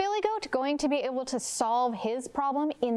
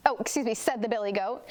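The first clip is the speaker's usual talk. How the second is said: ordinary delivery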